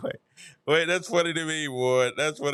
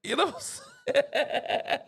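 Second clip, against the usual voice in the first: laughing; 9 semitones higher, much slower than usual. Real, not acted. Laughing at his own joke.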